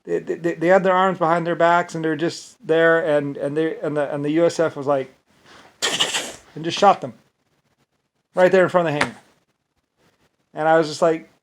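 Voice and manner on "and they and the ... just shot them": usual speaking voice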